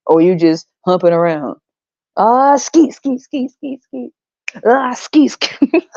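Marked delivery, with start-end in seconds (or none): 2.2-5.9 high, fading skeet sound effect; high mock skeet noises trailing off to a whisper. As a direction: crude imitation fading to a breath. Mocking ejaculation noises.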